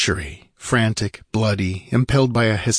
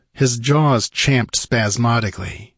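ordinary delivery, nothing marked

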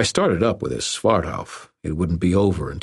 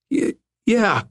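very high, startled stammer. A narrator's reading. Dangling bully answering his captor, high stammer. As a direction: stumble on the first sound, squeaking agreement. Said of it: "stammered the dangling brother".

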